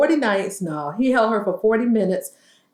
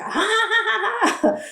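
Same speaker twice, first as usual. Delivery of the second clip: mocking laugh; 12 semitones higher than usual. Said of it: "He was like".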